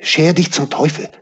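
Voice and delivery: sharp, high-pitched rebuff; hissed dismissal. A narrator's reading. Mistrustful innkeeper woman to Peter, hissing. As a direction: send the stranger packing angrily. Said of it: "zischte sie".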